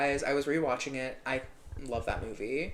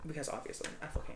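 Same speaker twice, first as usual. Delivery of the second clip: whispered aside; 11 dB quieter, faster than usual. Real, not acted. Hushed aside about singer.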